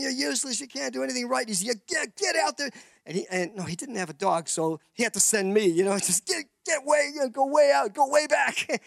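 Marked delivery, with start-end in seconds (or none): 0.0-2.7 higher exasperated berating; lightly voiced angry farmer; the farmer scolding the useless new worker; mock scolding boss. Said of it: "he was just going". 6.3-8.8 high, loud shouted orders; retold yell. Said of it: "he's yelling at me".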